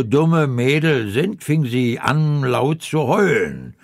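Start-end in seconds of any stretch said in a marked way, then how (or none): none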